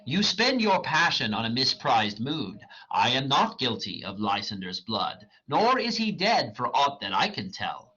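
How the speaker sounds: dramatic reading of verse; reading Shakespeare aloud. Acted. Reader voicing Hermia rejecting Demetrius, dramatic. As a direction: read the lines as the character, measured.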